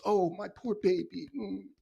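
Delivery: soft, mocking baby-soothing coo; lightly voiced mock nurturer soothing a crybaby. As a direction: hum it like comforting a baby, in mockery.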